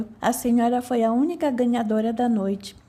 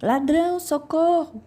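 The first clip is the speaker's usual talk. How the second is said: put-on old woman screaming; 5 semitones higher than usual. A narrator's reading. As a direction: scream for help at the intruder. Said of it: "gritava novamente a mulher". (velha gritando por socorro, mais alto)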